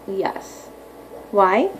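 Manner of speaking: ordinary talk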